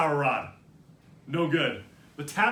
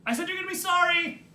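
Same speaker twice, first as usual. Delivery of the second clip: high, raised-voice repeat; yelled threat; 12 semitones higher, 4 dB louder than usual. A narrator's reading. Sister repeating her threat to brother, yelling. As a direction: shout the warning through the door.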